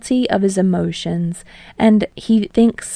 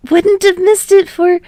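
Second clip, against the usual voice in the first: put-on high, loud boyish voice; eager boy, cut off; Midoriya voice; 12 semitones higher, 7 dB louder than usual. A narrator's reading.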